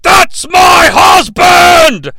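slow, drawn-out, high shout; anguished shout. Retold or imitated. Optimus screaming over Ratchet's death, hammed-up anguished shout. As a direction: wail it like he lost his love. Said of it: "He loses it".

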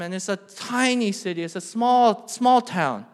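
loud pulpit delivery of scripture; projected sermon voice; forceful preaching. Real, not acted. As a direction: proclaim it firmly to the congregation. Preaches and reads verse forcefully.

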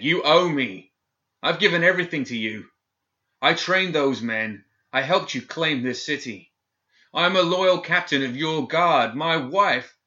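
loud, high desperate outcry; desperate shout. A narrator's reading. Captain shouting his loyalty at the governor through the door. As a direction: shout it with everything he has left. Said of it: "Gideon shouted".

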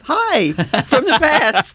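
slow, high, drawn-out hello; spooky time-traveler greeting. Pretend. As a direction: greet the future like a ghost. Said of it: "you did a Doctor Strange on me".

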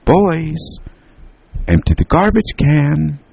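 high, sung-out summons; calling out. A narrator's reading. Mother interrupts boys yet another time, calling out. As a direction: call it out far above your talking pitch. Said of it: "their mother said".

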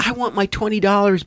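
lightly imitated high-pitched demand; mock heckler complaint. Retold heckler demanding a refund.

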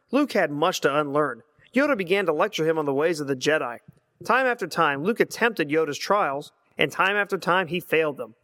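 ordinary talk

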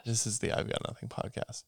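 hushed podcast intro whisper. Shown as a demonstration. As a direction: whisper the show name. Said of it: "show intro whispered".

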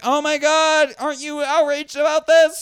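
high gushing mock outrage; mock outraged gasp. Mocking social media posters, high fake outrage. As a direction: parody a breathless outraged poster. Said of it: "just like".

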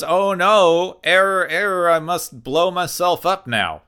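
malfunctioning robot in alarm; robotic error message. Acted. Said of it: "Nomad ... goes".

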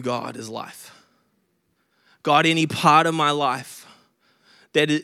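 leading a hushed prayer. Acted. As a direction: pray gently over the room. Leads the congregation in prayer.

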